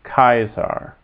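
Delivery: "Kaiser" as KAI-sar, starting on a K; hard C. Shown as a demonstration. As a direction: Latin "Kaiser" with hard K and "eye" vowel. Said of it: "makes a K sound".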